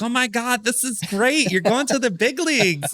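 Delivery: very high-pitched imitation of a thrilled mom; excited mom. Son mimics his mom hearing he's going to the big leagues, excited. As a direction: squeal it like an overjoyed mother, put-on high voice. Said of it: "My mom's like".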